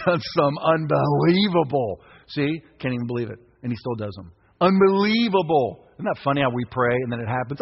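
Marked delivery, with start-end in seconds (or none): 4.6-5.9 slow, loud amazed word; awed marvel. Real, not acted. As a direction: draw it out in wonder. Preacher marvelling.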